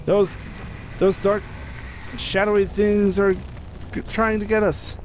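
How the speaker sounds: nervous, hunted, fleeing something; being chased. Real, not acted. Shadows chasing in horror game.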